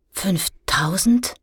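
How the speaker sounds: slow, hushed whispered rise; stammered disbelief. A narrator's reading. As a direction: breathe it out in disbelief. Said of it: "stammelte Luan".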